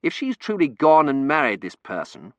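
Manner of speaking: dawning hope. Acted. Terence realises he is free.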